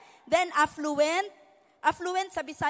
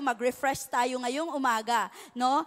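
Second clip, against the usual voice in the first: usual speaking voice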